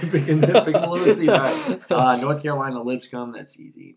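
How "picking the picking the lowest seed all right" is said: laughing through the words; chuckling while saying it. Real, not acted. Laughing at his own lowest-seed picks.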